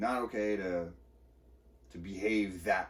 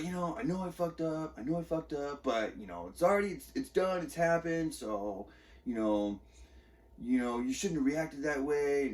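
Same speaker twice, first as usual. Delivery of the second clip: mocking wife; 6 semitones higher than usual. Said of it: "And she was like".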